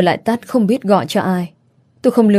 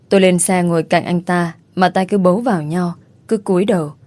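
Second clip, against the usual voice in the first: ordinary delivery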